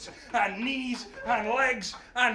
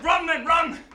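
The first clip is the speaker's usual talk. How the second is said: high, loud battle cry; urgent shout; 6 semitones higher, 8 dB louder than usual. Acted. Hercules to his men as the guards awake, urgent shout. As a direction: yell it to get everyone fleeing.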